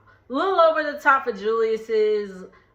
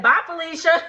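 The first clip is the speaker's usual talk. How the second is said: sassy dismissal; 6 semitones higher, 4 dB louder than usual. Retold or imitated. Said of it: "And she said".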